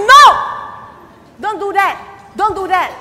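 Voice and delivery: high, loud firm warning. Retold or imitated. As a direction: stop them firmly. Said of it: "I said".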